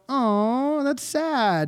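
high-pitched, drawn-out sympathetic coo; cooing pity. Pretend. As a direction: coo it high, as if pitying a little dog. Reacting to a little dog.

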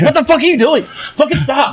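high, laughing mock scold; half-laughing yelp at a misbehaving kid. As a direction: squeal it through laughter like a caught-out parent.

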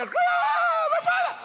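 long, high wailing shout; drawn-out dramatic wail; loud crying out. Retold or imitated. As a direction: imitate an anguished cry to the Father. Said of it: "Cried out loud".

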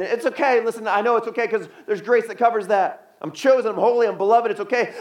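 high, insistent self-justifying churchgoer; self-excusing believer. Acted. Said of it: "who walks around and says".

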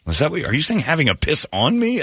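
ordinary delivery

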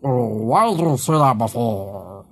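slow, mock merman voice. Host slips into his merman voice to tease co-host. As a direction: drag it out like a goofy merman. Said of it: "that was my merman".

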